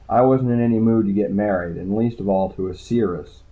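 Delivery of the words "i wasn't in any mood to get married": ordinary delivery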